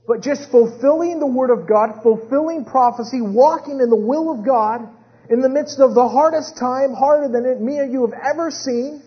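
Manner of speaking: loud, high, rhythmic pulpit build; impassioned sermon crescendo; full-force preaching. Real, not acted. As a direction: hammer out phrase after phrase with fervor.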